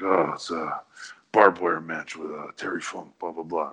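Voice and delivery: low, subdued deep-voice imitation; Sabu. Wrestler explaining his scar to the director, deep voice. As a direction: play a man of few words, low and trailing off. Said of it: "in his deep voice".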